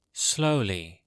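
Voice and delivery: normal talking voice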